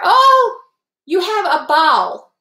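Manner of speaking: high, loud "Oh" then slow cheerful wish; excited send-off. Real, not acted. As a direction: wave a friend off gleefully. Cheering off departing friend.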